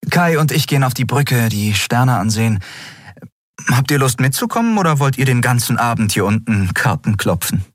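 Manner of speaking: a hint of the captain inviting the crew; cheerful captain. A narrator's reading. Endriel voiced by narrator.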